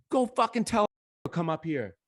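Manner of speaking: high-pitched, indignant order; mock angry demand. DJ sending the teller off to fetch someone, mock angry.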